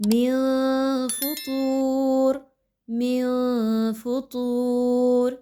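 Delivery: مِن فُطُورٍ with the noon hidden in a nasal hum; ikhfa on noon. Shown as a demonstration. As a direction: hide the noon of مِن, long drawn فُطُورٍ. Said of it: "کیسے؟". (نون کا اخفا)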